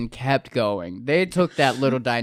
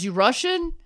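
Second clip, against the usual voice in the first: high mock accusing query; suspicious principal; 11 semitones higher than usual. Principal quizzing the cop, mock suspicious. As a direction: toss it off as a suspicious question. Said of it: "he goes".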